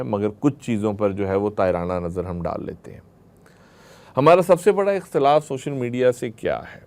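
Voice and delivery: ordinary talk, nothing marked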